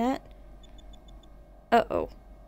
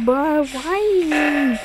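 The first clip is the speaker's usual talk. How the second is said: exasperated whine; 4 semitones higher, slower than usual. Real, not acted. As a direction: complain in disbelief, pitched up. Repeated complaint mid game.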